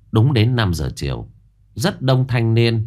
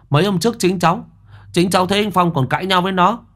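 much higher, fast, eager accusation; young nephew accusing; 10 semitones higher than usual. Acted. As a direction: insist hotly it was him. Young Thiết accuses Thành.

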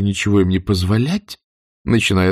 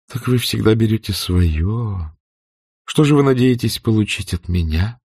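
coy murmur; 3 semitones higher than usual. A narrator's reading. Scarlett coaxing Rhett to flirt, coy murmur. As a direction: tease him into flirting. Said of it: "пробормотала, желая его поощрить".

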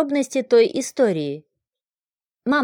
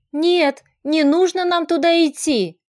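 higher-pitched anxious protest; urgent, raised-pitch refusal; alarmed outburst; 6 semitones higher than usual. A narrator's reading. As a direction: let the plea burst out in alarm. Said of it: "вырвалось у Вари".

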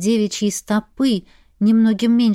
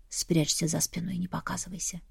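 whisper; 4 semitones lower, 10 dB quieter than usual. A narrator's reading. Mstislav warns her to hide.

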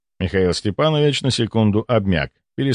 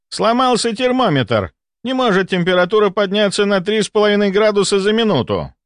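high-pitched, vehement denial; agitated disbelief; 10 semitones higher than usual. Acted. Doctor shakes thermometer, in agitated disbelief. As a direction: argue against the evidence. Doctor refuses to believe reading.

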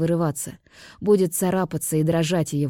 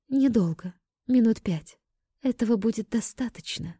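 put-on lightly raised girl voice; young woman voice; 5 semitones higher than usual. Selen speaks to Arman.

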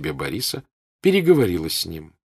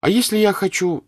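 put-on high, loud girlish voice pressing her point; a stubborn young girl demanding her way; girlish insistence; 13 semitones higher, 6 dB louder than usual. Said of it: "сказала Наташа".

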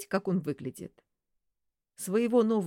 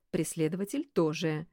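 ordinary delivery, nothing marked

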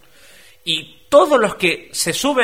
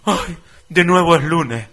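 slow, mock-weary sigh of a complainer; moaning pessimist; 2 semitones higher, 4 dB louder, slower than usual. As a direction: whine about Monday like a doom-sayer. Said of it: "aquellos que dicen". (queja lastimera fingida)

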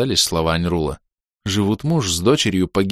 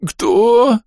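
high, tormented squeeze of a word; strained cry; 6 dB louder than usual. Acted. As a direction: force it out in agony. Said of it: "выдавил с мукой призрак".

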